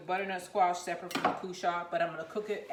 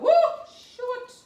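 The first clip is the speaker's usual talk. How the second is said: surprised yelp; 14 dB louder than usual. Real, not acted. Nearly burns herself.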